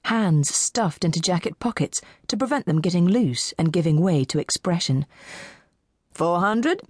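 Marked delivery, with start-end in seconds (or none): 6.1-6.8 auctioneer. A narrator's reading. Auctioneer calling for bids.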